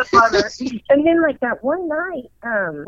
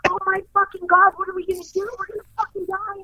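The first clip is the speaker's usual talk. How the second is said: high-pitched retold panic; panicking; 10 semitones higher, faster than usual. Daughter panicking over mom shivering with fever, acted high-pitched. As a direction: act out a frantic what-do-we-do spiral. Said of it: "I'm a panic. I panic".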